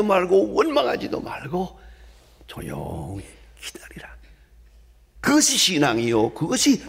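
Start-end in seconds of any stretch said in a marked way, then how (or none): 2.5-4.2 low, very soft, near whisper; quiet, low-pitched exhortation; hushed command. Acted. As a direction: drop to a hush to urge patience. Urging quiet waiting after loud list.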